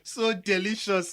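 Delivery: slow, gloating, laughing through it; gleeful laugh. Acted. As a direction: relish the gossip with a chuckle. Savouring the scandal, laughing.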